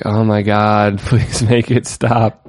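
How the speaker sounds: imitating exasperated bloggers begging for relief; groaning. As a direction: groan it like a long-suffering fan. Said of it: "starting to groan and toss and turn".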